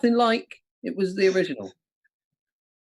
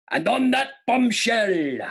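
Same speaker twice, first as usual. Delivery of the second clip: slow, drawn-out show catchphrase, pitched up; put-on catchphrase voice; 4 semitones higher, slower than usual. Host gives quiz catchphrase number two, slow put-on voice. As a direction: announce it slowly in a put-on accent. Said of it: "which one accent to do this in".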